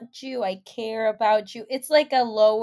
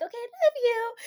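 much higher, singsong goodbye; acted sign-off; 13 semitones higher, faster than usual. Signing off FaceTime with guy friends, high playful sign-off. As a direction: end the call in a high little voice.

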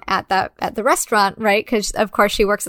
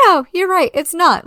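lightly voiced Allie; 7 semitones higher than usual. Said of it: "And she's like".

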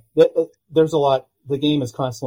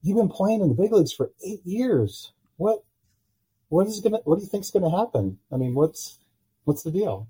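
incredulous disbelief; 7 semitones higher than usual. Retold or imitated. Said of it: "I'm like".

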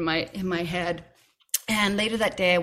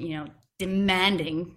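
ordinary delivery

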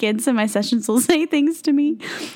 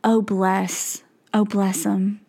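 put-on Southern accent; 5 semitones lower, slower than usual. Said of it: "Or I'll be like".

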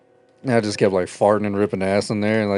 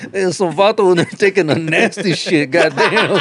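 laughing quote; 6 semitones higher than usual. Retold or imitated. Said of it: "He goes".

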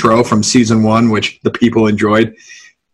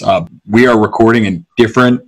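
ordinary delivery

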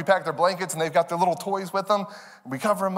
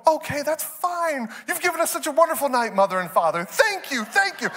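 put-on high, sing-song sweet child; angelic grateful children; 8 semitones higher than usual. Imagined obedient kids thanking parents at bedtime, exaggerated sweet gratitude. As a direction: gush with exaggerated politeness.

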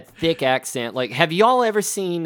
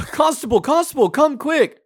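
high-pitched, far louder-than-life cry for help; panicked villager; 11 semitones higher, 4 dB louder than usual. Retold or imitated. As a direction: call the constable in mock alarm. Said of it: "they run out and they're like".

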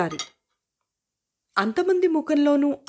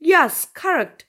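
waiter's confirmation; 6 semitones higher, 5 dB louder, much slower than usual. Acted.

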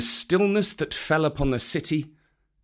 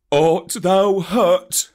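tender Baloo; 7 semitones higher, 6 dB louder, much slower than usual. A narrator's reading. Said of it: "said Baloo, hugging him softly".

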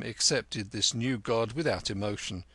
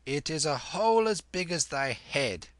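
a touch of a fretful vendor; 5 semitones higher than usual. A narrator's reading. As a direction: complain mildly like a fussy woman. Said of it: "said the woman fretfully".

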